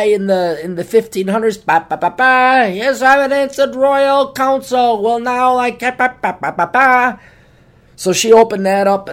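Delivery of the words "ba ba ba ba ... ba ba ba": high, slow trumpeting herald fanfare; mock royal herald. Imitating a herald reading a royal scroll, pompous. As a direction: announce it like a pompous court crier. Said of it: "like the trumpeter, uh, announcer guy".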